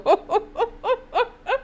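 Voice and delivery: high crying laugh; helpless teary laugh; high wheezing laugh. Real, not acted. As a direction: laugh till it squeaks. Cracking up.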